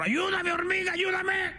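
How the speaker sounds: very high, hammed-up plea for help; squealed, desperate mock shout; panicked donkey. Drowning donkey calls the ant for help, mock panic.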